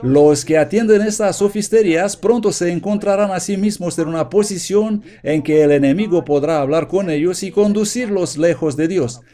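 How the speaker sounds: ordinary talk, nothing marked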